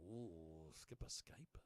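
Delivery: low, whispered mock ooh; mock hushed awe. Host teasing the Skype nickname, in a mock-awed whisper. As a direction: whisper the silly name as if impressed.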